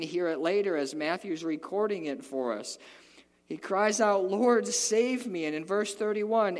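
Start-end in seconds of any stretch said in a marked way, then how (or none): 3.5-6.6 loud, high, slow preaching cry; full-force preaching. Acted. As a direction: preach it out to the church.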